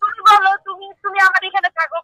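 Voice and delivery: normal talking voice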